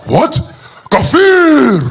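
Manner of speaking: sudden high, loud yell of "Kafir!"; angry shout. Retold or imitated. Outraged missionaries denounce the unbelievers, big shout. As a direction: shout it like an accusation. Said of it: "they were like".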